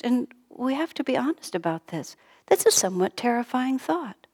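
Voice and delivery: ordinary talk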